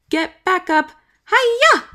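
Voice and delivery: high, loud battle cry; action yell. Acted. Branch freeing Poppy from the cocoon, loud yell. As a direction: yell it as you strike. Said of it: "Branch using his hair like a whip".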